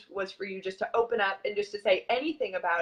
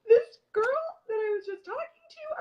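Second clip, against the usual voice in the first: high, loud, tearful retelling of bawling; tearful, half-sobbing memory; retold bawling; 13 semitones higher, 5 dB louder than usual. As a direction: replay the sobbing moment with a crack of real tears. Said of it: "I still remember bawling to Brian".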